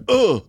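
high pained grunt after a smash. Retold or imitated. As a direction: grunt like you just got hit. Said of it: "you hear".

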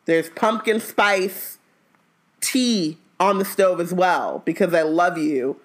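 slow, saccharine, then a jab; sugary slow with a sting; slow fake sweet.

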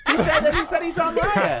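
high laughing protest, slowing down. Real, not acted. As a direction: laugh through the words, pitch up high. Laughing at friend's remark.

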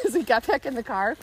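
ordinary talk

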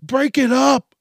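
high, loud mock yelling; panicked bystander yell. Zoo visitor yelling at fighting animals to stop, mock shouting. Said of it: "they're the person that's yelling".

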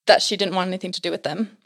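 ordinary talk

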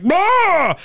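high, loud playful boo; mock jump-scare roar. Imitating the last scare of a horror movie. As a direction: pop out like a movie monster. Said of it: "the last scare of the movie".

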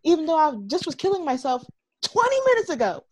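airy, clueless parody; imitating Landon shrugging it off; mocking Landon. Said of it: "And then Landon was like".

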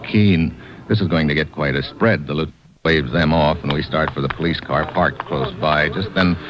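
low, even private-eye narration; hard-boiled narration. Acted. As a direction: tell it like a tired detective looking back. Hero narrates the noir story.

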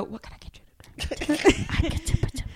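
mock a tiny-voiced actress taking an order; imitating a soft-talking waitress, high, soft and slow; soft-spoken waitress. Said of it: "had to go up to a table and go".

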